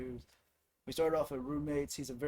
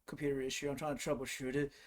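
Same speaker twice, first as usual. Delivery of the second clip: normal talking voice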